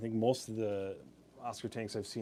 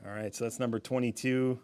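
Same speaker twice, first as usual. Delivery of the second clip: ordinary talk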